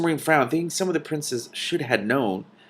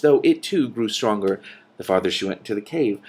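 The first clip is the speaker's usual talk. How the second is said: plain reading voice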